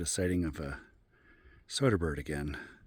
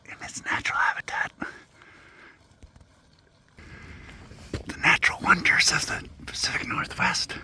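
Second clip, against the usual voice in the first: breathy documentary whisper; nature documentary whisper; 13 semitones higher, 8 dB louder than usual. Acted. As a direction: whisper like a hidden wildlife filmmaker.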